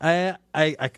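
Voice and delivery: ordinary delivery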